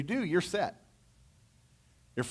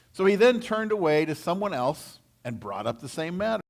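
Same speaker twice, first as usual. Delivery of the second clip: ordinary delivery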